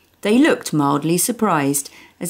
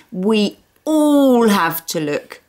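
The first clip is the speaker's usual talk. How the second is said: put-on high, slow little-girl command; bossy small girl; 5 semitones higher, 3 dB louder, slower than usual. Little girl ordering the whole family to search, bossy. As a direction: order everyone like a tiny general. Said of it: "she ordered".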